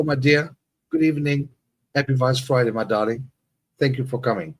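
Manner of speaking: ordinary talk, nothing marked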